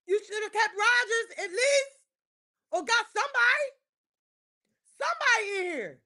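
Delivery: high, crying wail about the roster; anguished cry. Real, not acted. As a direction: wail it like a heartbroken fan. Frustrated fan shouting, crying.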